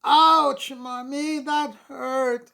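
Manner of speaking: put-on high, loud child's teary cry; tearful exclaiming. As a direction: wail it like a hurt little kid. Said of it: "I exclaimed as I burst into more tears".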